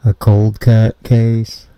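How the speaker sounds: slow loud muffled; through a wall. Acted. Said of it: "You, you sound muffled".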